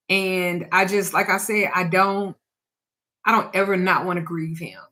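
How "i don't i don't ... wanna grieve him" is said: ordinary delivery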